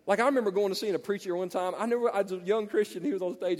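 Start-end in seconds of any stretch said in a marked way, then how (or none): none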